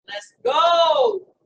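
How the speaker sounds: loud, drawn-out cheer; rallying shout. Real, not acted. Coach urging entrepreneurs on to exercise, rallying shout.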